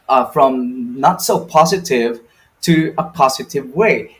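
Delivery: usual speaking voice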